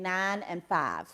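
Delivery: Alabama twang on "nine and five"; Southern accent. Shown as a demonstration. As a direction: flatten "nine and five" into Southern long-ah vowels. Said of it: "if I did not stop saying".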